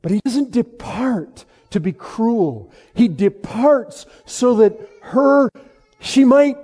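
high, loud, forceful sermon delivery; impassioned preaching. Real, not acted. Preacher explains why Christ departs for seasons, impassioned preaching. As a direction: press it on the congregation with full conviction.

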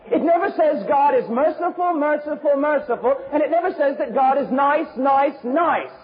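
high-pitched, repetitive pulpit emphasis; forceful preaching. Real, not acted. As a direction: hammer each repeated word.

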